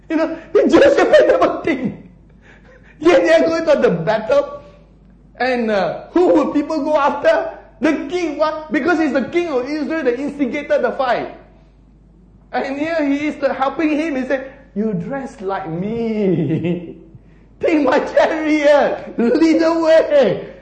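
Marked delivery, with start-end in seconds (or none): 0.1-14.1 high-pitched, incredulous sermon delivery; impassioned preaching. Real, not acted. Preacher marvels at Jehoshaphat's folly to congregation, impassioned. 14.7-20.5 drawn-out, stretched command; mock kingly command. Acts the scheming king's orders.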